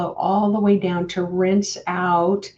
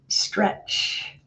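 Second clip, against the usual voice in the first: usual speaking voice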